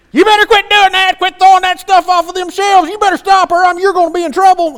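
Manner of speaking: high, loud imitation of a scolding mother; screaming mama. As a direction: screech threats like a mom at her wits' end. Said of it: "mama's over here screaming".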